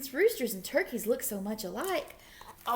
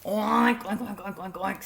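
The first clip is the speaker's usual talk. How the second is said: snorted pig oinks; snorting piggy oinks; 4 dB louder than usual. A narrator's reading. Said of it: "snorted Pig".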